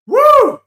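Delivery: loud, very high "woo"; real goal-celebration whoop; celebratory whoop. Real, not acted. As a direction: whoop at the top of your range.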